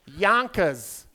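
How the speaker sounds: local New York pronunciation, "Yonkers" without the R; Yonkers accent. Retold or imitated. Southern comic mimics how locals say Yonkers, New York accent. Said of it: "How do you say it?".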